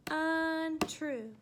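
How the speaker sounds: "Untrue" loudly sounded out in two beats; syllable by syllable. Shown as a demonstration. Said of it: "two syllables".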